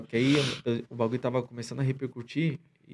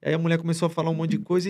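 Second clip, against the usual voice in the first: normal talking voice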